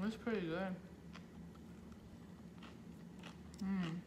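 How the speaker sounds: talking while chewing food; muffled words between bites; mouth full, chewing. Real, not acted. Seen 1: Bites chopstick-held food at 1.6, chews through the labelled words.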